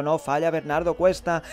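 ordinary talk

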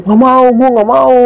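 high, drawn-out tearful refusal; imitated bawling. Retelling Mawar refusing after the warning, imitated bawling. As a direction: mimic a friend wailing in protest. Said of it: "Nangis, Bang, kejer".

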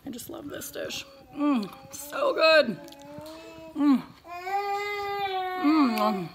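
slow soft murmured delight; savoring. Real, not acted. Mom tasting her dish, soft savoring murmur. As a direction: savor every word quietly.

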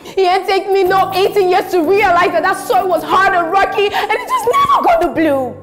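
shouting it out, high and loud. Acted. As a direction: let it all out at full voice. Wife's outburst to Troy.

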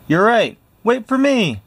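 the brave officer suddenly scurrying after his men; panicked commander. Acted. The Big Cheese running after his soldiers, squeaking in panic.

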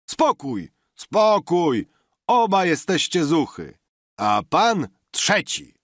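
high, loud calls over a quarrel; calling for calm. A narrator's reading. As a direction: shout the squabblers down. Biesiadnik uspokaja kłócących się. (głośne, wysokie nawoływanie do spokoju)

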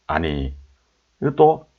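ordinary delivery

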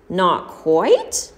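"Not quite?" rising in alarm; the beggar echoes "Not quite?" with a startled rise; shocked question. A narrator's reading. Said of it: "shivered crazily".

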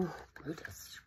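low disapproving whisper; hushed scolding. Real, not acted. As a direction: whisper his name with a tsk.

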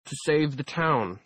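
slow, muffled behind a mask. Acted. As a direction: speak through a metal mask. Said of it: "she replied in a muffled voice".

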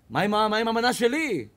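mock indignant diner; indignant complaint. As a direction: demand your missing dish. Diner complaining dish missing.

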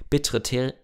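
plain reading voice

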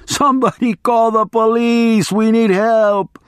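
high, slow, hammed-up cry for help; shouting for help. A narrator's reading. Attorney's high hammed-up cry for the police. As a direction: wail it out in mock panic. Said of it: "he shouted".

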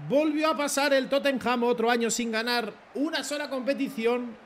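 high, slow, grand announcer voice; sports commentator. Acted. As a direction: call it like a cup final commentator. Commentates the trophy win.

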